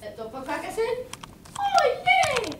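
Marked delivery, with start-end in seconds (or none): 1.6-2.5 much higher, louder joyful squeal; delighted squeal. Acted. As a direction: squeal with sudden joy. Pokaka delighted to marry.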